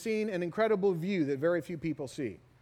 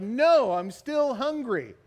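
loud, raised wail on the no; mock protest; 5 semitones higher, 5 dB louder, slower than usual. Still hungry after being sick, loud mock protest. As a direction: cry out in comic frustration. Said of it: "I was like".

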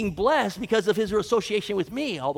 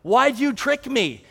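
loud, higher furious yell; 6 semitones higher, 7 dB louder than usual. Acted. Jacob yelling at Laban over the swapped bride, loud and furious. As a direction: shout it straight at the man who cheated you. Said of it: "I come in yelling".

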